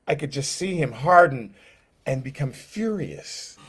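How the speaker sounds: ordinary talk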